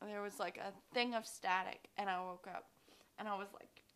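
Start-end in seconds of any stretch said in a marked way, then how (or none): none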